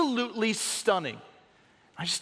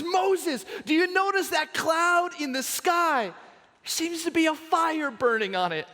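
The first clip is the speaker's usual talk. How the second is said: calling out; 8 semitones higher than usual. Pretend. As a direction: shout it up to Moses, pointing at the sky. Someone calls out to Moses.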